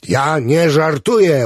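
high, slow, stretched-out insistence; insistent protest. A narrator's reading. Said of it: "upierał się Clevenger".

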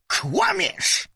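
high, loud furious shout; deranged teacher roaring at the boy. Acted. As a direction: blast the accusation at him. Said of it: "huknęła pani Price".

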